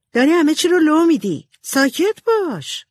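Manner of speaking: high, urgent telling-off; scolding. A narrator's reading. Jo to sister giving away the secret, scolding. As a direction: shut your sister up, voice pitched high. Older sister hushes the other.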